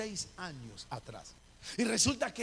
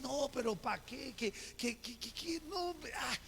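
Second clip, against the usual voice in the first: higher, stammered mock protest; playing reluctant; 4 semitones higher, 4 dB quieter than usual. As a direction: act coy, tripping over a repeated refusal. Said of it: "yo haciéndome el rogado". (haciéndose el rogado)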